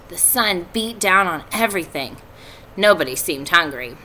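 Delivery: put-on steady read-aloud narration for kids; story narration. A narrator's reading. Teacher reading a chapter to her students, in story narration.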